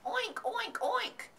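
high playful oinking; pig oinks. Pretend. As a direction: oink like a pig for fun. Boy playing pig, oinking.

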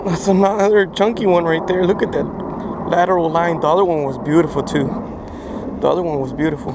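loud thrilled fish praise; yelling with delight; excited shouting. Real, not acted. Loud excited new catch.

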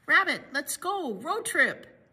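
a friendly call across the yard. A narrator's reading. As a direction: raise the voice to call Rabbit out. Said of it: "called Bear".

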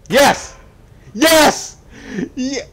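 high, loud "yes" bursts; triumphant shout. Real, not acted. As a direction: cheer each word hard. Elevator starts, celebrates.

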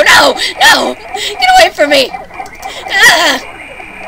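high, loud terrified screams; screaming in fear. Real, not acted. Gamer fleeing a monster, terrified screaming. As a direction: scream and run from it. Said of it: "running screaming from it".

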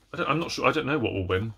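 ordinary talk

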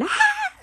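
sudden high scream; mock child scream. Voicing the child waking to a scream. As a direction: cry out like a terrified child.

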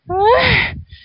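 a long high moan, mostly sighed. Acted. Hidden in the brush, a long high moan. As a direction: let out a long moan while alone in the brush. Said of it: "He moaned".

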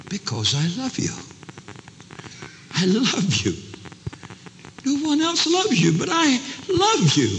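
high, fervent love declaration; impassioned declaration. Acted. Husband pulling his wife closer, fervent declaration.

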